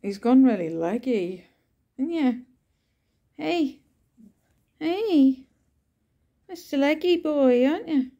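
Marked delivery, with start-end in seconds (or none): 2.0-8.1 high sing-song voice for the cat; pet talk. Real, not acted. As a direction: coo at your leggy cat, pitched way up. Cooing at her cat.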